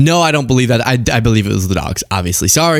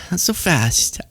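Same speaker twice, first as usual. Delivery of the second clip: quieter, tossed-off echo; soft repeat; 4 semitones higher, 5 dB quieter, slower than usual. Acted. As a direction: drop it down like an aside. Teasing retort, said twice.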